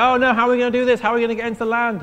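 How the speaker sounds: God's people at the desert's end, mock panicking; mock panic. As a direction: play the worried crowd, a bit hammed-up. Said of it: "and they panic".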